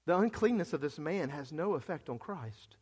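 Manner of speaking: usual speaking voice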